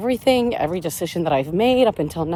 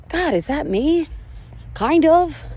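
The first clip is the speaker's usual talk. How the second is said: self-mocking realization; 11 semitones higher, slower than usual. Real, not acted. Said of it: "And I'm like".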